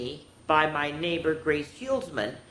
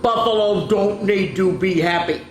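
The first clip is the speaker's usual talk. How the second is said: loud, higher grumpy buffalo; snorting; 5 semitones higher, 6 dB louder than usual. A narrator's reading. Gloomy Bismarck rejecting happiness, snorting. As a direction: declare it loudly, dismissing the idea. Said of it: "snorted Bismarck".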